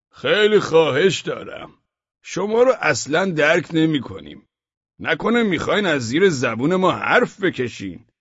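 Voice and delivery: a merchant who smells a trap; wary merchant. Acted. Suspicious merchant.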